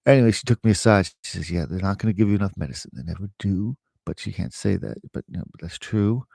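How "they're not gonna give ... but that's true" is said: ordinary talk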